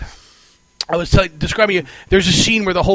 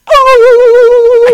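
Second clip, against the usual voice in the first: a loud, high, startled "Oh!"; sudden loud exclamation; 15 dB louder than usual. Retold or imitated. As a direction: belt it out like a movie sound effect. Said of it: "and then you hear".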